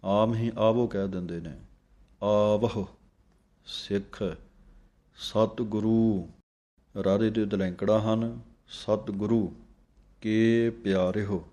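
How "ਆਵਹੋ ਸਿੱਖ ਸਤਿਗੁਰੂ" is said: slow, measured reading of Gurbani; scripture recitation. Shown as a demonstration. Teacher reciting Gurbani slowly for students to copy. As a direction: recite each phrase slowly and clearly.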